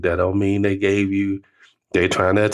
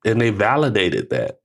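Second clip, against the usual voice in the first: ordinary delivery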